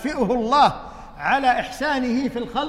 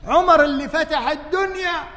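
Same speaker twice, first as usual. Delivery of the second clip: raised preaching voice, higher, louder; preaching shout; 7 semitones higher, 6 dB louder than usual. Real, not acted. Preacher to congregation about Umar, loud proclaiming. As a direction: proclaim Umar's greatness.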